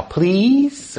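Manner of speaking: high, rising plea; emphatic please. Shown as a demonstration. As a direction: beg with one drawn-out word. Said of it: "a kind of emphatic please".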